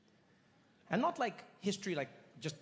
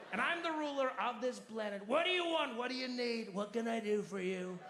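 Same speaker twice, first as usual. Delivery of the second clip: Trump impression; 8 semitones higher than usual. Trump impression rant, alien reply.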